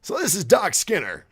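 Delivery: high, loud announcer voice; character voice. Acted. Host first introduces himself in a hammed-up voice. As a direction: belt out the name like a showman. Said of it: "character voice".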